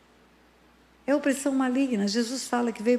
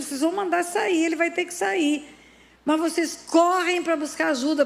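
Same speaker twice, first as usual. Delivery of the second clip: raised, forceful preacher's exhortation; preaching; 5 semitones higher than usual. Acted. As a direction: press the point hard, as from the pulpit. (pregação enérgica, em tom elevado)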